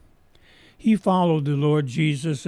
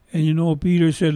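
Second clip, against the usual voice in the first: normal talking voice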